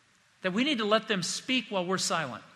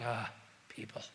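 sighed out, very soft and low; weary sigh; 7 semitones lower, 13 dB quieter than usual. Shown as a demonstration. Jesus sighing at the crowd, soft weary sigh. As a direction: let it fall out on a tired sigh. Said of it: "Did he say it with a sigh".

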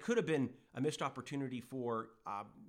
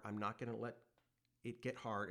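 normal talking voice

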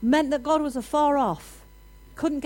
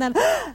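sharp startled gasp; a child's sudden gasp of fear; mock gasp of fright; 9 semitones higher, 6 dB louder than usual. Mimics child's fright at peekaboo.

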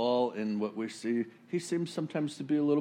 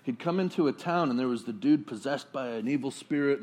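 normal talking voice

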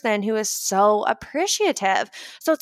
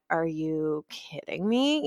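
muttered irritation; 4 semitones lower, 6 dB quieter, slower than usual. Retold or imitated. Acted inner irritation.

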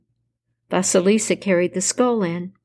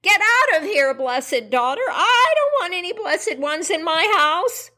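much higher shrieking witch; 10 semitones higher than usual. Acted. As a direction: shriek her out of the house. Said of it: "Baba Yaga shrieked".

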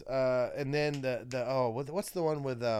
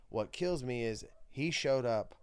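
ordinary talk, nothing marked

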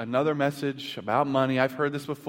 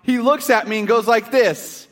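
loud, high, driving sermon voice; full-force preaching; 7 semitones higher, 9 dB louder than usual. Acted. Pastor telling the congregation a story, loud preaching. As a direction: preach it out to the room.

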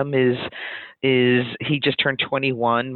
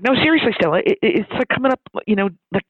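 high, urgent retold plea; pitched up, eager and stumbling; excited insistence; 7 semitones higher, 3 dB louder, faster than usual. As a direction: imitate an excited friend insisting. Co-founder insisting after giggling fit.

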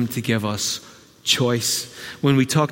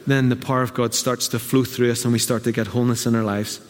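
ordinary talk, nothing marked

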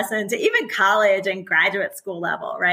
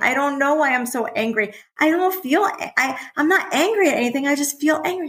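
put-on upset child; 7 semitones higher than usual. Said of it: "I'm like".

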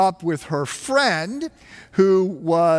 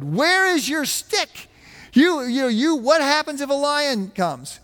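imitating a horrified guide, pitched much higher; mock alarm, voice jumping up high; 9 semitones higher than usual. Maasai guide scolding the pastor for losing his stick, horrified and high. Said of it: "he would always be so horrified".

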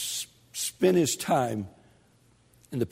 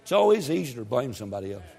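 ordinary talk

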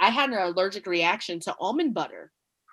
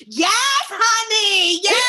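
high, loud, slow joyful cheer; cheering a friend's daughter's acceptance; loud excited cheer; 12 semitones higher, 5 dB louder, much slower than usual. Retold or imitated. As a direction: celebrate the news at full voice. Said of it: "All loud".

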